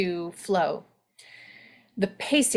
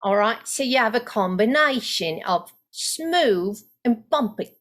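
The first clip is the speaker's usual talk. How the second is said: Cockney accent; 3 semitones higher than usual. Shown as a demonstration. Coach demos the Cockney flow in a Cockney accent. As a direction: slip into Cockney, up in pitch. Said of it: "So if I'm doing Cockney".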